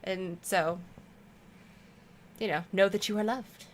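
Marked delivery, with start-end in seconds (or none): none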